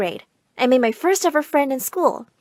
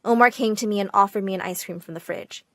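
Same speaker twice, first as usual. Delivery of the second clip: ordinary delivery, nothing marked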